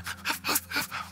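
imitated breathy, heaving sobs. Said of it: "My wife is crying, worse than I".

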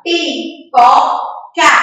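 Pipoca very slow, as pi, po, ca; open-mouthed syllables. Shown as a demonstration. Teacher asking children to join in, Pipoca slow and syllable by syllable. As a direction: sound out Pipoca in three separate beats. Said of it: "Abrindo a boca, falando também".

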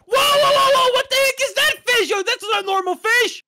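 loud, high-pitched alarm; panicked shouting, pitch shooting up; startled scream. Acted. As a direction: cry out in shock at a monster. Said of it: "Why did you scream?".

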